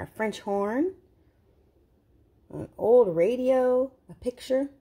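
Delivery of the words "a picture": ordinary delivery, nothing marked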